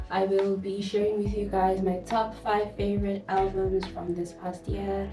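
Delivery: usual speaking voice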